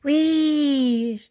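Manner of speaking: very high, loud whee; ghostly whistle. Acted. Percy sneaking up on Thomas, ghostly whistle. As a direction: shriek like a ghost engine. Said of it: "Percy gave his ghostly whistle".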